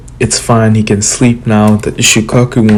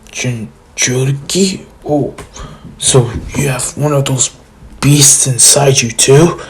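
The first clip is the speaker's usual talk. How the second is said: Gaara voice; 2 semitones higher, 3 dB quieter than usual. Retold or imitated. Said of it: "Gaara's like".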